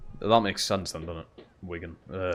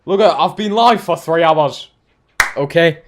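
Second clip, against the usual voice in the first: louder and higher, fed up; pleading with chat after a long stream; exasperated; 6 semitones higher, 9 dB louder than usual. Real, not acted. Tired streamer raising his voice at chat. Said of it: "what happens when you've got to talk for three hours".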